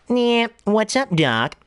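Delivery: slow, high wisecracking rabbit; cartoon rabbit catchphrase voice; Bugs Bunny. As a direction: stretch the opening, then drop down. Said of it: "a lot of people like Bugs Bunny more".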